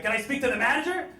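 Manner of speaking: mock the classic entitled customer; manager demand.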